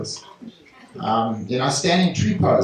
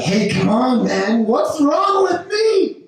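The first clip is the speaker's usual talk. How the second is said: high, slow, shouted plea; hammed-up shouting whine; mock desperate plea; 9 semitones higher, much slower than usual. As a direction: wail it up high at the girl who won't talk to you. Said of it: "I just said".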